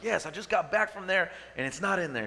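mock-defensive answer to a doubting wife; mildly exasperated reply. Husband retells answering wife about truck, mildly exasperated.

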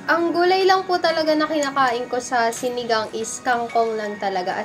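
ordinary talk

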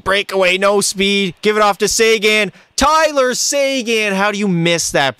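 high, rising calls building to disbelief; exasperated calls. Real, not acted. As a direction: urge the breakaway, then groan at the miss. Frustrated play-by-play of missed chance.

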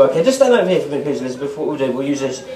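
ordinary talk, nothing marked

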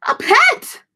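high, thrilled squeal; excited fish voice. Acted. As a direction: cry out with delight. Gilbert greets the fly.

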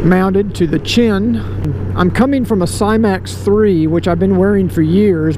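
muffled behind a closed visor; riding with the helmet on; speaking inside a helmet. Real, not acted.

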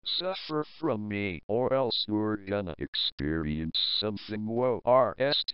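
an evil computer greeting its victim; menacing computer voice. Acted. A villainous computer threatens the user, low menacing voice.

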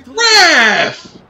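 high, loud call; yelling for the ref. Real, not acted. Calling the referee to count the pin, loud yell. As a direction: shout for the referee.

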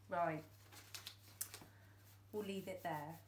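plain reading voice

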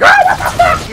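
put-on very high, girlish shriek of fright; mock startled scream. Player acting out someone jumped from round a corner, startled scream. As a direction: imitate being scared out of your wits. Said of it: "I scream like a little bitch".

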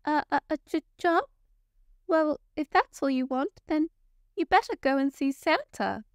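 high, stammering small elf voice; timid little elf. A narrator's reading. Timid elf answering a polar bear, high stammering voice. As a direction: stutter it out nervously, then gather courage. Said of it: "A timid little elf".

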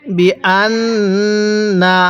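বি আন না with the doubled n sustained; ghunnah on নুন. Shown as a demonstration. Teacher shows learners the ghunnah rule, long hummed n in আন. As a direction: hold the n of আন in a long hum before না. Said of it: "গুন্নাহ করে পড়তে হবে".

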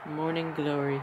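hushed doting over the dog; quiet cooing. Real, not acted. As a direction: murmur fondly to the dog.

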